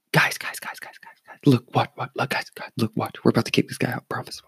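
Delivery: hushed, rapid-fire attention grab; excited stage whisper, barely voiced; urgent whisper. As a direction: re-enact secretly calling coworkers over to watch. Secretly summoning fellow bartenders to watch.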